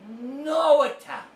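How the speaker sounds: high, loud, hammed-up roast shout; impersonating a roaster shouting at the dais. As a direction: belt it out like a roast comic heckling. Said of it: "he comes up and he goes".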